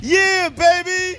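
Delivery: high, drawn-out cheer; excited whoop. Real, not acted. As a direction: stretch it out high in real excitement. Whooping after laughter, hyped up.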